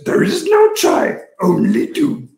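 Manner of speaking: high, slow, strange little master's voice; Yoda voice. Retold or imitated. Host imitating Yoda to his guest. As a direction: croak it out as the wise old Jedi. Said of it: "Please let me do the voice".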